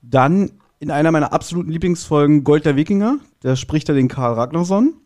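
ordinary talk, nothing marked

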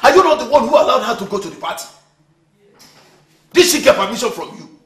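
loud, high accusing questions; indignant yelling; angry shouting. Acted. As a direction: shout the blame straight at your partner. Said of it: "Don't shout at me like that".

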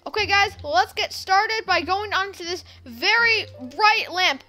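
ordinary talk

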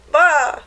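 mock sheep call; sheep bleat. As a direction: bleat like a lamb. Imitating sheep.

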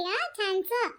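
ordinary talk, nothing marked

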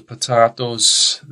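"potatoes" with a long AH, puh-TAH-toes; joke British pronunciation. Shown as a demonstration. Teacher contrasting with Americans, "potatoes" as puh-TAH-toes. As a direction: draw out "potatoes" with TAH in the middle. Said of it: "we say potatoes".

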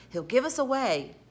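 irritated, pushed-up reprimand; scolding. A narrator's reading. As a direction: warn crossly with a jump in pitch. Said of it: "scolded Tom in a hoarse whisper".

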